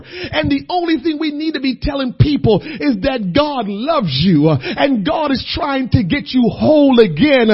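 loud, high, driving sermon delivery; full-force preaching. Acted. Preacher urging the congregation, loud and fervent. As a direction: preach it out with fire.

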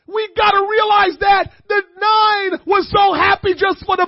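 loud, high shouted preaching; rising shouted sermon; full-force preaching. Acted.

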